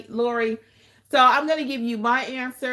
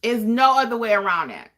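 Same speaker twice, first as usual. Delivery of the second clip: ordinary delivery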